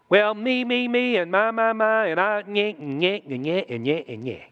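mock a selfish whiner, trailing off; mock whining. Preacher mocking a self-centred believer, childish whine fading.

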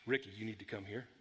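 imitated low, hushed summons; low, soft voice that means trouble; ominous summoning tone. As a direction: call him over quietly and seriously. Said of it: "I didn't like that tone".

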